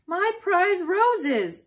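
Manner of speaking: slow, high, loud anguished shout. A narrator's reading. Neighbor seeing roses wrecked, slow loud shout. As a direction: wail it over the ruined roses. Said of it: "shouted Mister Gruffalo".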